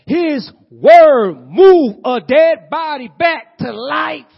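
slow, loud and high proclamation; preacher shouting the miracle; fervent preaching cry. Acted. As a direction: drag each word out in awe. Preaching climax.

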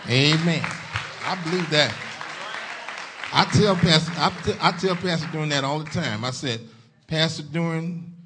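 loud, lifted preacher's voice; preaching. Real, not acted. Pastor praising their preacher to Zion, preaching. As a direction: proclaim it with conviction to the church.

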